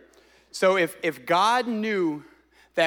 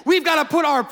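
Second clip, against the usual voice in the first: building fervent sermon; preaching; 6 semitones higher, 3 dB louder than usual. Acted. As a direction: preach it with rising fire. Pastor preaching with rising force.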